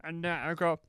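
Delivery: ordinary talk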